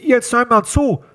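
high, slow villain with pinched nose; menacing phone caller disguising his voice; disguised nose-pinched voice. A narrator's reading. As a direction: talk as if holding your nose. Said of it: "als würde jemand die Stimme verstellen, indem er sich die Nase zuhielt".

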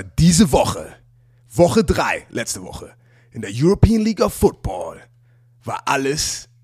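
slow, dramatic trailer announcer; movie trailer voice. Acted. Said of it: "ich muss jetzt in meiner Trailer-Stimme reden".

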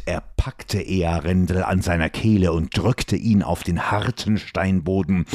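plain reading voice

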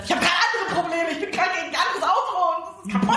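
fast, high inner panic, laughing through it; panicked inner thought. Retold or imitated. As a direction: rattle it off in a laughing, half-panicked rush. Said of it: "weil ich dachte". (hastig, hoch und lachend, innere Panik)

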